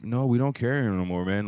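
clerk voice. Retold or imitated. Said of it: "He gives me this".

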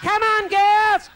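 slow, high, loud drawn-out call; bossy matron call. Retold or imitated. Maud summoning the girls, slow and high. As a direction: stretch it out like a sergeant major. Maud calling the women again.

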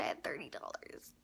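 angry whisper under the breath. Real, not acted. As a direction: whisper it, fed up and annoyed. Frustrated, drops to whisper.

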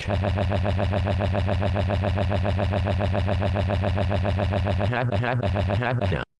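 long, droning stuttered noise; monotonous machine-gun "ha-ha-ha"; imitated noise. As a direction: rattle off one syllable mechanically, on and on. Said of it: "fucking the piano like".

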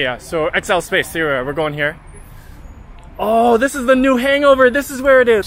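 normal talking voice

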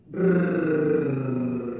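"Rrr" as a long, even tongue trill; trilled R sound. Shown as a demonstration. Teacher showing tongue on palate, long rolled "Rrr". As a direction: roll "Rrr" against the palate. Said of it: "Vamos a pronunciar poniendo la lengua".

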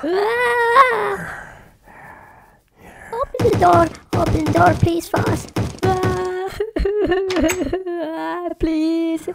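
high, frantic begging; panicked pleading. Pretend. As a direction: beg to be let in, panicking. Pig begging at the door.

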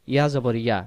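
ordinary talk, nothing marked

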